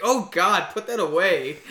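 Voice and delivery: high, loud mock outcry; exclaimed dismay. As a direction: exclaim it as a retold horrified reaction. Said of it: "she's like".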